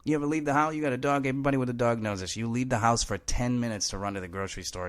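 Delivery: normal talking voice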